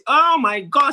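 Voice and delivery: high loud outburst; exasperated cry. A narrator's reading. Tricked man bursting out in frustration, high and loud. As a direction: throw up your hands and cry out.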